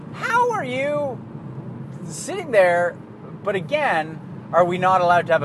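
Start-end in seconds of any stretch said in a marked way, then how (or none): 0.1-2.9 high, slow, drawn-out disbelief; incredulous. Retold or imitated. Reacting to a grammar-mangling Facebook critic with slow incredulity. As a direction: stretch it out, baffled at the commenter. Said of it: "And I'm like".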